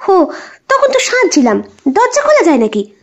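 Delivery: snapping answer. Acted. Anu snapping at her husband about the door, irritated and quick. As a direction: snap back at him, annoyed. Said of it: "ঝাঁঝি মেরে উত্তরটা দেয় অনু".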